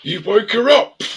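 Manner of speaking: high, loud mock scream; mock-furious shout, far above normal pitch; angry yell. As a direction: yell it like someone storming downstairs. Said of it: "the angry footsteps come down the stairs".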